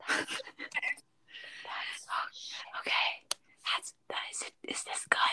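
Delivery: leaning in to whisper. Pretend. Continuing the ASMR whisper.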